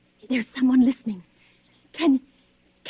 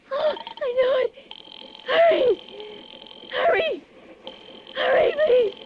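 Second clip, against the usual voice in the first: high, frantic begging; panicked pleading; 7 semitones higher than usual. Acted. Woman begging police as the killer climbs the stairs. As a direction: cry out for them to hurry.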